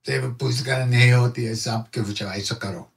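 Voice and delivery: quick Hindi said in the guru's voice; lightly voiced guru in Hindi; Maharaji speaking Hindi. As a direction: answer like the guru, matter-of-fact. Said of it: "He looked at me and said".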